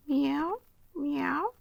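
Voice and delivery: high rising meows; a cat miaowing to get in; cat meowing. Acted. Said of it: "interrupted Simpkin".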